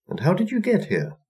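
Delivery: put-on high, gentle old woman's question; a sweet old crone asks kindly. A narrator's reading. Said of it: "asked the old woman nicely".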